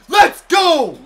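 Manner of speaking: huge high-pitched celebratory yell; triumphant shout. Real, not acted. Gamer celebrating a base hit, triumphant shout. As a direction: explode with joy at full volume.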